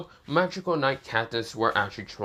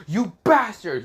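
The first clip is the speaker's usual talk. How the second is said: higher, much louder enraged yell; furious shout; 10 semitones higher, 7 dB louder than usual. Acted. Lucas, stripped of magic, lunges at Asta with a furious shout. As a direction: scream it at him as you lunge. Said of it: "with these anger eyes and says".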